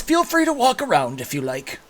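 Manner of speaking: high-pitched host voice; Corwin character voice. Acted. Corwin lets guests look around, high-pitched host voice. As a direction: offer it freely, pitched high. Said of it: "he says".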